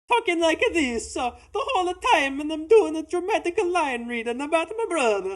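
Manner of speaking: high, hammed-up Italian caricature; cartoon Italian accent. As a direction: overdo a squeaky Mario-style Italian accent, loud and dramatic. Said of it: "Like, cartoon, Jared Leto, House of Gucci".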